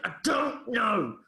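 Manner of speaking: slow, high mock shout of a boy; angry boy's outburst. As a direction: force out each word as a cornered, angry child. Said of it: "he clenched his fist and went".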